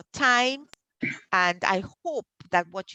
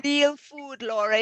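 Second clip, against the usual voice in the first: broad Trini accent, pitched up with a laugh; Trinidadian accent; 6 semitones higher than usual. Real, not acted. Host to a listener about food, in a playful Trinidadian accent. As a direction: lean into your home Trinidadian accent. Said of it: "my real Trinidadian accent".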